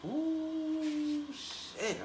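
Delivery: high squealed ooh then a breathy shit; shocked ooh. Real, not acted. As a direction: gasp it out like you can't believe it. Shocked reaction.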